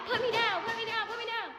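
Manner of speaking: mock squealing protest. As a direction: squeal to be put down. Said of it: "So I'm like".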